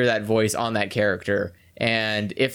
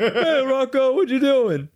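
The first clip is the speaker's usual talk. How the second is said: cartoon cow voice; 12 semitones higher, 3 dB louder, slower than usual. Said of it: "he was like".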